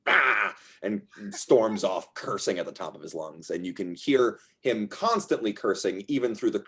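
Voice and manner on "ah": short exasperated "ah"; acted growl of frustration; frustrated yell. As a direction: let out a wordless burst of temper. Rick storms off in frustration.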